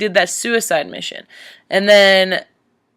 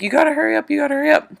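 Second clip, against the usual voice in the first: put-on higher, pressing quote of the girl; urgent hurrying girl; 7 semitones higher, much faster than usual. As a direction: urge him to hurry, lightly voiced. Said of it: "She's like".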